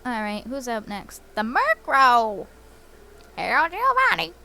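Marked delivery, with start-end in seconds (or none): 1.3-4.3 high, slow, delighted call; cheerfully calling out; excited. Real, not acted. Greeting the Pokemon excitedly.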